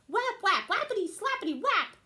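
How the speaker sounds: high, loud sound-effect whaps; excited whapping. Acted. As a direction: bang it out with gusto. Camilla whapping her head.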